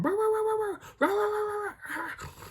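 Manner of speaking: high, rapid-fire yapping; string of high barks, tailing off; imitated dog barking. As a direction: mimic two dogs barking frantically. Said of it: "Dexter and Angie start barking".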